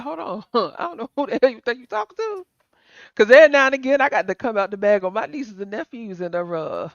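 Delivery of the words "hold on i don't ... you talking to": quick, higher, softer indignant challenge; puffed-up challenge. Acted. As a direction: chest swelled up, mock confrontation. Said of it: "swell your chest up and be like".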